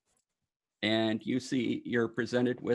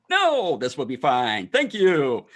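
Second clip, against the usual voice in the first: louder, higher Warforged thanks; loud robot voice; 5 semitones higher, 8 dB louder, slower than usual. Acted. Warforged thanking the gnome, loud and high. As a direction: answer like a hearty metal man.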